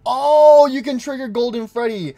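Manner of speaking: high, loud delighted oh; thrilled; excited. Real, not acted. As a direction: genuine excitement. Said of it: "excited discovery".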